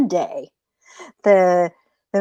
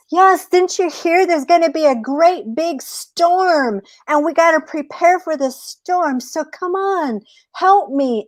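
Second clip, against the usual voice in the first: much higher, hurried coaxing; urgent rabbit; 9 semitones higher than usual. Acted. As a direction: rush him into helping. Rabbit urges coyote about storm.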